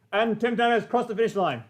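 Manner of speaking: excited mock horse-race commentary; race commentator. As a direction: call the finish like a track announcer. Mock race call in naming story.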